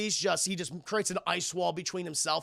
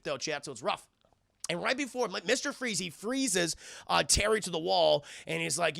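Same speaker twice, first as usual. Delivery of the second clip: ordinary talk, nothing marked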